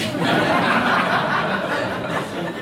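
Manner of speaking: high airy laugh; amused breathy laugh. Real, not acted. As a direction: laugh out at the irony. Laughs at his own story.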